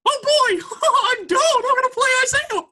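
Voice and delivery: very high, loud Mickey Mouse squeak; Don impression. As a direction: imitate an excited cartoon mouse, laughing. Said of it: "He literally sounds like Mickey Mouse".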